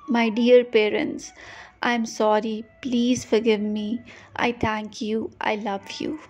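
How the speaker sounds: ordinary talk, nothing marked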